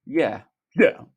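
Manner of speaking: loud, blustering agreement; put-on hearty interjection; Boris. A narrator's reading. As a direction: chime in as a pompous politician. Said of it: "Boris, chiming in".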